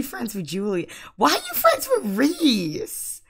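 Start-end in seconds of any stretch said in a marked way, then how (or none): 1.2-3.2 indignant. Real, not acted. Scolding Sim.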